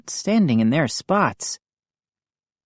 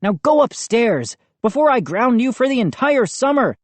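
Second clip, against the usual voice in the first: higher, fast irritated threat; snapped; 8 semitones higher than usual. A narrator's reading. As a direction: threaten to ground him. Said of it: "he snapped".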